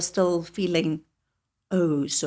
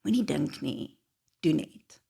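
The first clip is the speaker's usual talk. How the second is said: lightly voiced Afrikaans-accented quote; 4 semitones lower than usual. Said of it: "he says, the one character".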